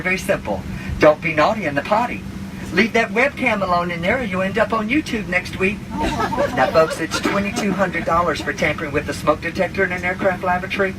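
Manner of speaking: ordinary delivery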